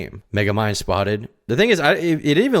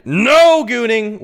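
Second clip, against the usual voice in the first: loud, high, long-held NO; scolding shout; 12 semitones higher, 8 dB louder, much slower than usual. Real, not acted. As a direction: bellow it like a firm ban. Scolding chat to stop.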